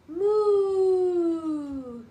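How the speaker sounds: high, loud drawn-out moo; mother showing her baby how a cow sounds. Shown as a demonstration. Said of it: "Does a cow go".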